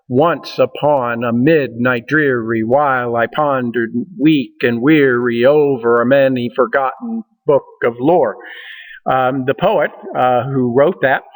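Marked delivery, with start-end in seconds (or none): 0.1-8.4 chanted in a strong trochaic beat, weak and weary stressed; rhythmic recitation. Shown as a demonstration. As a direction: pound out the meter of The Raven. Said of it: "you can hear the rhythm in that".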